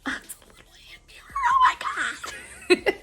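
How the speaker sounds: unvoiced, breathy squeal of delight; breathless squeal. Real, not acted. Discovering a tiny hand mirror, breathless squealing. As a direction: gasp it out, too thrilled for voice.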